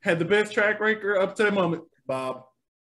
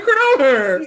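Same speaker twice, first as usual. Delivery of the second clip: 1 excited yell; 8 semitones higher, 10 dB louder than usual. Retold or imitated. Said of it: "I'm like".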